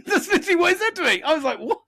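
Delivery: high, incredulous burst through laughter; laughing disbelief. Real, not acted. Man telling what the mechanic said, laughing incredulously.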